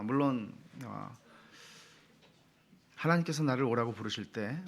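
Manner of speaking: normal talking voice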